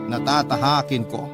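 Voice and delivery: ordinary talk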